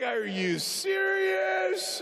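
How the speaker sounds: high, slow incredulous squeal; hammed-up astonished question; mock disbelief. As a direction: stretch it out like a stunned passenger. Stunned passengers' reply acted out.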